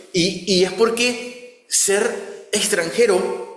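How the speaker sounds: ordinary talk, nothing marked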